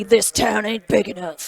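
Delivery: lightly put-on Western accent. Commentator reading a card's name in a hint of Western accent. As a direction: read it like a mild Western cowboy. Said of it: "not in a Western accent".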